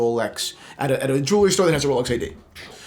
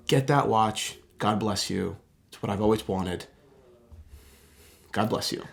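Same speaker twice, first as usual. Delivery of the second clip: quiet grateful; 8 semitones lower, 7 dB quieter than usual. Retold or imitated. Tells buddy to get watch.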